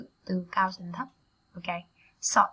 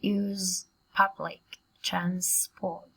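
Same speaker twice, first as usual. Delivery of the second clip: slow, separated words with gaps; much slower than usual. Shown as a demonstration. Teacher models a long phrase for students, slowly word by word. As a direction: spell out the phrase one word at a time. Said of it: "Các bạn đọc nhanh hơn cùng cô nào".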